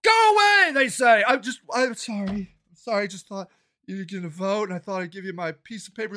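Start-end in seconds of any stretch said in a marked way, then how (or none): 0.0-0.7 high mock door-slam yell; imitated yell. Householder turning away a canvasser, mock yelling. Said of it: "getting yelled at at people's doors". 1.3-6.1 small, soft, lower apologetic voice; meek apology. Retold or imitated. Canvasser apologizing at a stranger's door, meek and soft.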